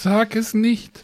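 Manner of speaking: high, slow, pleading "sag es nicht"; drawn-out high plea. Acted. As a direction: stretch it out in a high, playful plea. Begging him not to name it.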